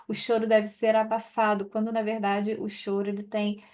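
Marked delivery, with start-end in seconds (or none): none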